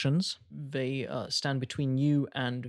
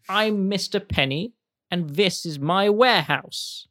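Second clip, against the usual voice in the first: lightly voiced pompous owner; 6 semitones higher, 5 dB louder than usual.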